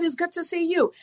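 ordinary delivery

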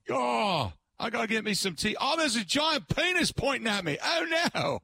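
mock British guy, high and alarmed, rising into a yelp; hammed-up morning panic at the window; startled Brit. Host imagining a Bath local waking, high mock alarm. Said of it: "you just picture some guy waking up".

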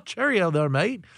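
a cheeky mock British accent, Cheerio and mate. Teasing his co-host in a playful mock British accent. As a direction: tease him in a hammed-up British accent. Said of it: "when you speak with a British accent".

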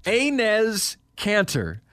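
Enes very slow as AY-NEZ, then Kanter; careful pronunciation. Shown as a demonstration. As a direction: enunciate Enes Kanter one piece at a time. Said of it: "You did it again".

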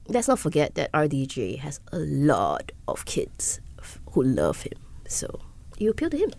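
hushed aside, voice dropped to a whisper. Real, not acted. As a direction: whisper it like a secret. Aside about RDJ's young fans.

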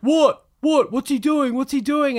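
high, loud, startled questions; alarmed bloke. Retold or imitated. Dog owner startled by the commotion, high alarmed questions. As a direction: sound suddenly alarmed and confused. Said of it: "I heard this bloke go".

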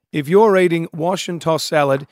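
normal talking voice

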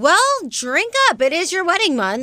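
toast him playfully; cheerful high. Real, not acted. Cheering drunk host.

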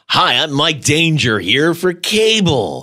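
high, slow fake ad-read voice; hammed-up radio pitchman impression; Mike Danger. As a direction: read it like a mock commercial. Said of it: "my fake Mike Danger voice".

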